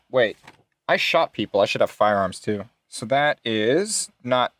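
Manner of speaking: ordinary talk, nothing marked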